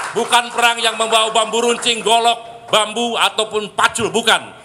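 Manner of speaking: loud, high fiery oration; rousing shout. Acted. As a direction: preach it to the rally. Union leader rallying crowd.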